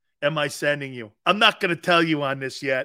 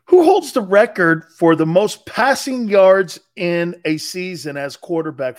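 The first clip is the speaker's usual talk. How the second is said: raised, deliberate query; calling out; 2 semitones higher, 10 dB louder than usual. Real, not acted. Calling a question to the assistant.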